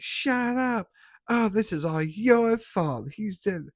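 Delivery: mock high-pitched wail; obnoxious screaming girl. Reviewer mimics the girl in the car as boyfriend dies, obnoxious shrieking. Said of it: "obnoxious screaming girl".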